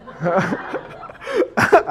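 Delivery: long, high-pitched laugh; amused high laughter. Real, not acted. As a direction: let out a real, high laugh. Laughs at own example.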